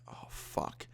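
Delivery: very soft, voiceless whisper; whispered dread. Retold or imitated. Hiding as the dad nears the bed, whispered dread. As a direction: breathe it out as a whispered inner thought.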